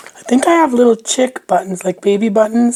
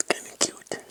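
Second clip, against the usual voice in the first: whispering; 15 dB quieter than usual. Real, not acted. Musing to herself while crafting.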